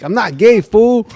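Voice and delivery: high, mock-tearful protest; retold crying. Storyteller acts out friend's brother's assailant, mock crying. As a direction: imitate a man crying as he insists. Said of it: "and he was crying".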